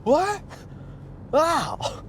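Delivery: very high-pitched mock sportscaster exclamations; badly faked, squawked one-word outbursts; Harry Caray. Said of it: "here's an impression of Harry Carey".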